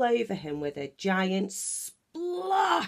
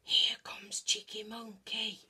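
whisper; 4 semitones higher, 6 dB quieter than usual. A narrator's reading. Hiding animals whispering.

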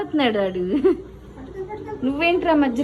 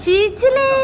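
much higher, louder "చిచిలే" squealed; 10 semitones higher, 4 dB louder, slower than usual. Real, not acted. Aunt squealing "చిచిలే" high and loud at baby Mahi.

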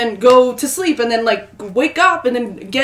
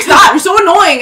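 louder, higher mock-annoyed outburst; retold bickering; 6 semitones higher, 10 dB louder than usual. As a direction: act out snapping at a sibling. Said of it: "we end up fighting".

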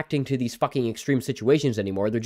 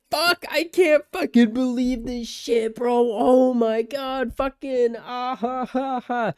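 much higher, slow mock voice of giggling teens; mocking teen fans; 10 semitones higher, slower than usual. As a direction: ham up a silly kid cracking up at swearing. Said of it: "they said".

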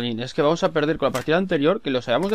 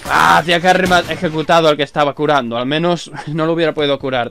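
loud, raised frustrated complaint; loud exasperation; 3 semitones higher, 9 dB louder than usual. Real, not acted. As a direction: exclaim in loud dismay. Teammate killed while healing.

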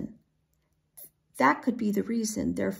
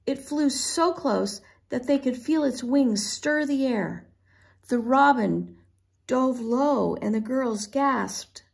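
ordinary delivery

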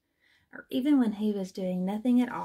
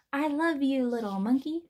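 raised, higher storybook shout; 4 semitones higher, 4 dB louder than usual. A narrator's reading. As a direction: call it out brightly to a friend, a bit louder. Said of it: "Albert shouted".

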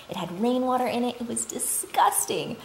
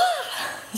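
exasperated sigh; 5 dB quieter than usual. Retold or imitated. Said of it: "you're like".

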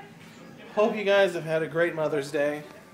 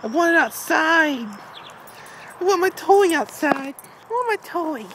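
higher whiny pet voice for the dog; voicing the dog; 6 semitones higher than usual. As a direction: speak for the dog begging for his toy. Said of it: "It's like".

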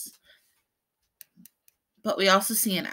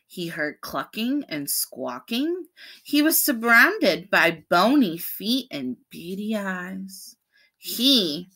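plain reading voice

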